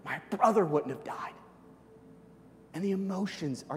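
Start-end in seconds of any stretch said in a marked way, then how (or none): 0.1-1.3 high, loud, a touch of despair; lightly voiced, heartbroken reproach; grieving sisters. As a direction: plead through grief at Jesus. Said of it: "They look at Jesus".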